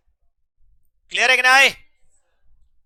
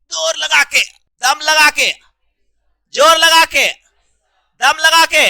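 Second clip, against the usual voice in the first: loud, high rhythmic effort chant; mock heave-ho shout; 5 semitones higher, 10 dB louder than usual. Teacher pretends to push a wall, mock straining shout. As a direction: shout it in bursts, as if shoving hard.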